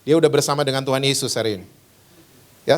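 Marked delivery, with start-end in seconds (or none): none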